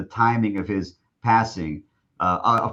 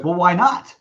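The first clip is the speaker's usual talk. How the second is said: mock indignant; 8 semitones higher, 5 dB louder than usual. Coach arguing with tour guide.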